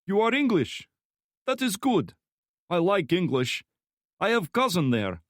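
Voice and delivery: put-on high, loud thick Slavic accent; heavy Slavic accent. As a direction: Slavic thug voice, friendly menace. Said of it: "speaking in a heavy Slavic accent".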